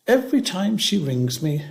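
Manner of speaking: plain reading voice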